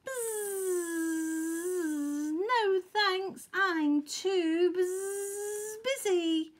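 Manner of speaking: high, drawn-out buzzing bee voice; Honeybee. A narrator's reading. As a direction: let the buzz break into the words. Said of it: "hummed Honeybee".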